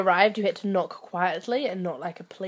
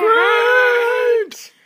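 high shouted word; playful shout; 9 dB louder than usual. Acted. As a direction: yell it out playfully. Playful intro exchange.

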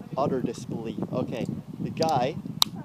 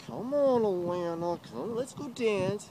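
pleading call; 8 semitones higher than usual. Retold or imitated. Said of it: "I'm like".

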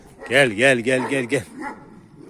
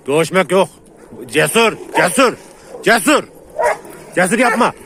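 yelling to stop a dogfight; 8 semitones higher, 5 dB louder than usual. Real, not acted. Stopping a dog fight.

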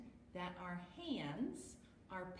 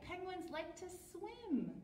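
ordinary delivery, nothing marked

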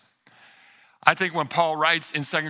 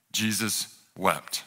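slow, low and softer verse reading; hushed, drawn-out gravity; solemn; 8 semitones lower, 5 dB quieter, much slower than usual. A narrator's reading. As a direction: let each word fall slowly and softly. Solemn quote of short memory verse.

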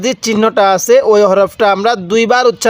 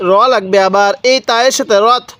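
ordinary talk

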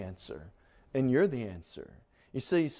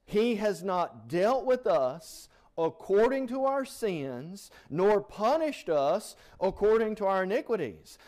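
raised, louder preaching read; fervent scripture reading; 6 semitones higher, 4 dB louder than usual. Acted. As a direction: proclaim the verse to the congregation. Preacher reads Psalm with fervour.

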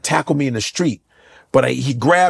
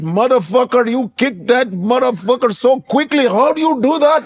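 amazed shop owner; 11 semitones higher than usual. Retold or imitated. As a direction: rave about the kick like a shopkeeper in awe. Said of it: "There's an Indian man".

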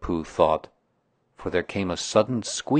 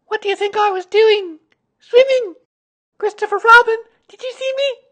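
very high, loud excited squeak; tiny child boasting to everyone; Roo; 11 dB louder than usual. A narrator's reading. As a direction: squeal each question, bursting with pride.

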